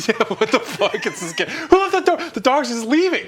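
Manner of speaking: high laughing disbelief. Real, not acted. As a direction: laugh through the words. Laughing at the art.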